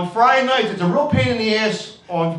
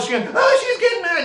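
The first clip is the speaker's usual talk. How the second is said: put-on much higher squealing party girl; mock giddy bachelorette; 14 semitones higher than usual. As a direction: squeal it like a tipsy bridesmaid. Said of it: "but they're all like".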